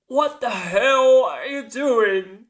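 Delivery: high, loud, drawn-out cry of disbelief; outraged. Retold or imitated. Jacob sees Miranda shoot Wilson, outraged. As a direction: imitate a shocked, outraged shout.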